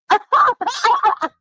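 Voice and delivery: high, bright burst of laughter. Real, not acted. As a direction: laugh at your own joke. Laughs at own joke.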